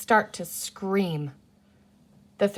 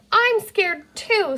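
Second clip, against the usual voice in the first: high, slow small frightened voice; scared little girl; 12 semitones higher, 5 dB louder, much slower than usual. A narrator's reading. Little girl admits to her sisters she's frightened, small and slow. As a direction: say it meekly, trembling a little.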